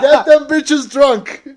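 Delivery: high, loud, stretched-out party cry; gleeful drawn-out yell; rowdy egging-on. Pretend. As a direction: shout it slowly to rally drinkers, laughing. Egging listeners on in drinking game.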